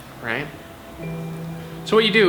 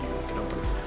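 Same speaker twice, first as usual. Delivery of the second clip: whisper; 9 dB quieter than usual. Shown as a demonstration. Guiding a short eyes-closed moment.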